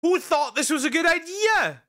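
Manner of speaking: shocked ranting shout; incredulous yelling. Real, not acted. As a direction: yell at the screen in disbelief. Shocked outburst at the creepy prop.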